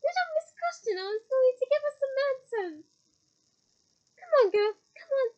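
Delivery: high, loud eager pressing; eager coaxing. Acted. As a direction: goad her eagerly. Umbridge pressing Hermione eagerly.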